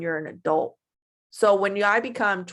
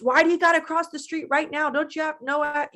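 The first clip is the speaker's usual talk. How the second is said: higher-pitched mock irritation; mock angry; 6 semitones higher than usual. Acting out someone mad at a person crossing the street, mock angry. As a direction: grumble indignantly at a stranger, pitched up. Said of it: "you're just mad at that person".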